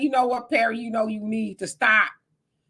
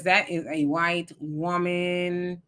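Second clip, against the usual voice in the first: slow, drawn-out, lower-pitched verdict; emphatic insistence; 4 semitones lower, 3 dB quieter, slower than usual. Real, not acted. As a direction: spell it out word by word, immovable. Insisting against anyone who disagrees.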